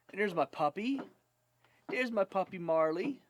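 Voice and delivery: bright, high and excited. Real, not acted. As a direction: show off the puppy happily. Showing puppy to camera.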